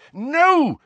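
high, loud, angry denial; boy angrily rejecting the teacher's doubt. Acted. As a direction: throw the word out in anger. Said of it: "said Harry angrily".

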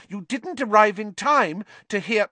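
high, earnest schoolgirl voice; earnest girl. Acted. Hermione earnestly correcting the professor, high and eager. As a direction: plead her case earnestly. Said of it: "said Hermione earnestly".

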